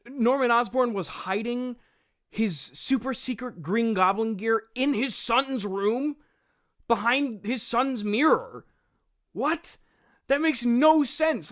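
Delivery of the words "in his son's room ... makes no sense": high, loud, slowed incredulous outburst; exasperated disbelief. Real, not acted. Reviewer reacting to the script's absurd plot hole with exasperated disbelief. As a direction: spell out how absurd it is.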